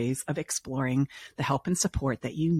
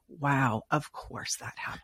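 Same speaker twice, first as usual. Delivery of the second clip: softer, fading to a breathy whisper; hushed realization; 5 dB quieter, slower than usual. Retold or imitated. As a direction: let the realization slip out under your breath. Client's realization, acted quote.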